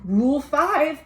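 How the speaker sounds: ordinary delivery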